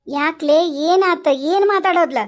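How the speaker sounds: usual speaking voice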